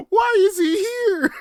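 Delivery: high, loud, slow, cracking with laughter; laughing cry. Acted. Repeating his own outrage, laughing high wail.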